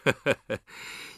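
a brief acted chuckle; amused chuckle. A narrator's reading. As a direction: chuckle at the child. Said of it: "李元芳看着他笑了".

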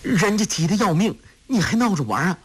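put-on high-pitched, flustered young woman's protest; exasperated, anxious. A narrator's reading. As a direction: scold a teasing lover in a high, frantic voice. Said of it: "穆念慈跺脚说".

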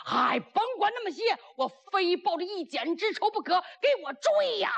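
high-pitched, heated order; acted fury, pitched right up; vengeful command. Vengeful general orders pursuit.

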